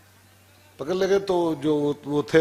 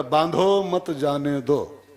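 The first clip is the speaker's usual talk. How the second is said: slow, pause after "बांधो", then "मत जाने दो" together; measured "बांधो, मत जाने दो"; written order; much slower than usual. Shown as a demonstration. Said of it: "अब लिख दिया पर्ची पर".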